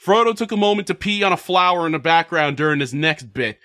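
ordinary talk

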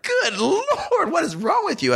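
high, put-on voice of shocked buddies; incredulous friends. Friends react to his outburst.

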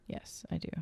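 whispered aside; breathy whispered yes. Real, not acted. Whispered answer.

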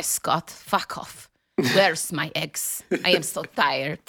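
slow, tired mock Italian accent. Host imagining herself at home with husband, slow mock Italian accent. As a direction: drag it out like a weary Italian diva. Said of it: "talking like [name] and [name]".

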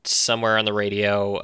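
normal talking voice

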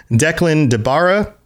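ordinary delivery

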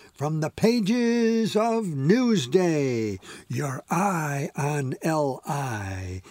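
slow, grand mock slogan read; newspaper slogan. Anne teasing her fiancé with a slow mock newspaper slogan. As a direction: announce it like a jokey newspaper ad. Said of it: "as she quoted the Long Island newspaper slogans".

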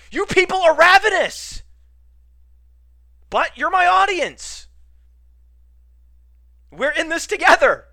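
high, loud rant at the audience; ranting shout. Acted. Host berating his ravenous audience, ranting shout.

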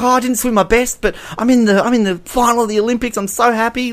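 high, bubbly, fast imitation of a thrilled young swimmer; high giddy mock-gushing girl; imitating happy swimmer. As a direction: gush like a teen just happy to be there. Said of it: "she's like".

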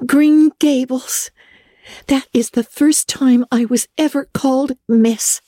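put-on high, breathless girlish thrill, lingering over the address; excited young Anne. Anne showing Marilla her invitation letter, high and thrilled. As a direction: savour every word like a treasure.

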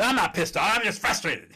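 high, loud protest of not being angry; indignant denial. Retold or imitated. A labeled man snapping back at the speaker, high and loud. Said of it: "You say".

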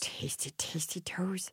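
breathy creepy whisper. Pretend. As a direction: whisper it like a secret. Whispering about tasty toes.

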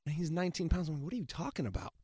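ordinary talk

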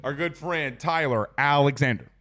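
normal talking voice

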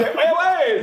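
high-pitched, eager imitated colleague; colleague calling out. Radio colleague hailing his buddy with an offer, excited imitation.

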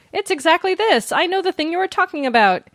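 lightly voiced eager fan; mock listener letter. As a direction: play an excited fan writing in. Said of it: "write to us being like".

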